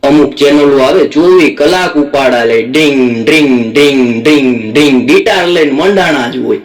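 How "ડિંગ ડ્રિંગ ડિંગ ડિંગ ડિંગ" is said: imitated guitar twang.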